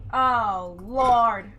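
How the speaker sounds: loud, stunned oh Lord; loud exclamation. Real, not acted. Player stunned by a perfect throw, loud cry. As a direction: cry out in disbelief. Reacting to once-in-lifetime throw.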